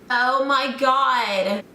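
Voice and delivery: drawn-out American "oh my God"; gushing American. Acted. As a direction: stretch it out in an American accent.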